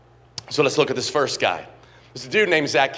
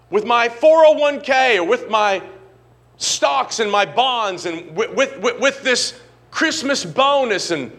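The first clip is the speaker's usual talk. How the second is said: building prayer; 7 semitones higher than usual. Retold or imitated. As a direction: plead with God, climbing higher as the sums grow. Voicing his own prayer to God.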